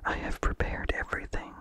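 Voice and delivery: ordinary delivery, nothing marked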